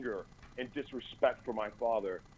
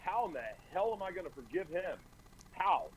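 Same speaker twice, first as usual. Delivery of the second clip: retold yelling at God; 7 semitones higher than usual. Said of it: "And I'm yelling again".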